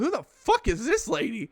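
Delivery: high-pitched retold disbelief; bewildered disbelief. Man asking his buddy about the lady grabbing him, high bewildered disbelief. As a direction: replay a baffled demand, voice jumping up.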